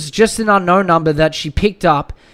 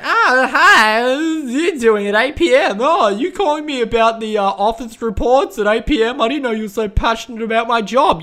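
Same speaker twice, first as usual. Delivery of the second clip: higher, laughing sleazy flirt; imagined sleazy caller; 5 semitones higher than usual. Pretend. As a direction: giggle it like a creep on the phone. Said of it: "this is what I imagine went down".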